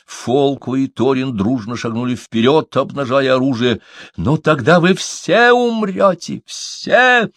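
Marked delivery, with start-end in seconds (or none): 4.2-7.3 high, piercing shriek. A narrator's reading. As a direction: scream the threat in fury. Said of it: "взвизгнула Тубала".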